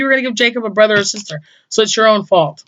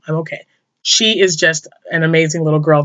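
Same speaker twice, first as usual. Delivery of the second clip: ordinary talk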